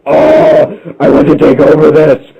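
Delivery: loud, booming villain boast; villain ape boast. Acted. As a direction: announce the takeover like a cartoon baddie. Giant ape head villain boasts.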